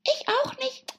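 very high-pitched hare voice; March Hare, chiming in. Acted. March Hare agreeing with the Hatter, very high. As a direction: chime in with a high animal voice.

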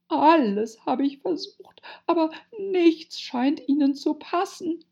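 high, loud, wailing pigeon voice; sobbing lament. A narrator's reading. Pigeon complaining of endless snake trouble, tearful wail. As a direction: wail it as if near sobs. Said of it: "fügte mit einem Schluchzen hinzu".

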